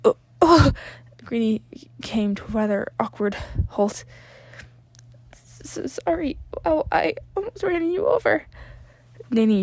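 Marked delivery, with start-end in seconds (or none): 0.1-0.7 put-on flustered boy. A narrator's reading. Midoriya flustered. 5.3-8.5 put-on higher, nervous stammering boy; flustered boy. A narrator's reading. Midoriya apologising awkwardly.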